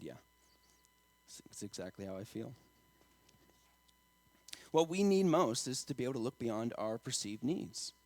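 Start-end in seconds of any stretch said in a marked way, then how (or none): 0.0-2.5 soft, low reply, understated; crying friend, imitated. Crying friend agreeing with the preacher, understated soft imitation. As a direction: voice a tearful friend's reply very quietly. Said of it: "crying. He just nodded and said".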